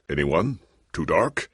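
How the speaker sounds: ordinary talk, nothing marked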